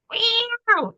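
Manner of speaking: high mock cat meow; catty meow. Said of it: "and saying something nasty".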